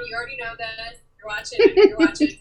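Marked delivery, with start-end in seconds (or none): 1.2-2.4 playful call-out. Real, not acted. Teasing her mom watching the show.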